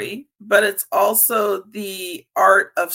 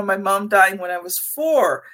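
usual speaking voice

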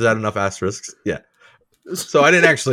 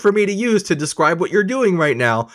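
exasperated quote; 9 semitones higher, 4 dB louder than usual. Retold or imitated. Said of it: "You're like".

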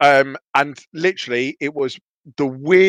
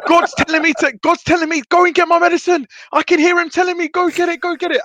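high wild-eyed babbling; frantic raving. Retold or imitated. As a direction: rave about hearing God. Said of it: "I was like".

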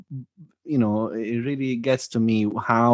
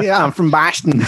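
lightly put-on Boston accent, pitched up; 4 semitones higher than usual. Co-host mimicking a Bostonian, put-on Boston accent. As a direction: have a quick go at a Boston accent. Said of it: "I actually do have a Boston accent".

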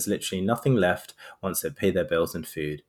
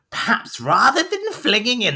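high, loud little imp; put-on high imp voice; 12 semitones higher, 7 dB louder than usual. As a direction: squeak it out like a meddling imp. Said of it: "that's how an imp will speak".

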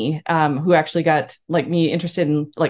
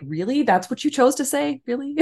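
mock disbelief at a blunt remark; playfully appalled, voice lifting; incredulous reaction; 8 semitones higher than usual. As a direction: act out taken-aback questioning.

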